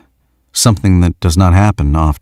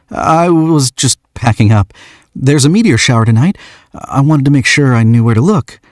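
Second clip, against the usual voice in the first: put-on shy young man; 8 semitones higher than usual. Young man explains to the stranger, shy and higher. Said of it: "he says".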